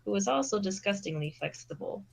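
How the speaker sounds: ordinary delivery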